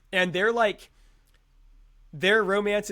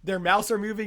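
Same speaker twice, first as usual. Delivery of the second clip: usual speaking voice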